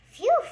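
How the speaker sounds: relieved phew. A narrator's reading. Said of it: "he said".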